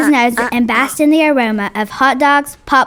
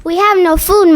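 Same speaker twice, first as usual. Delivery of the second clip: slightly louder complaint, lightly acted; a child reading a yell without much force; small raised voice, not a real shout; child's mild yell; 5 semitones higher, 3 dB louder than usual. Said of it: "she yelled again".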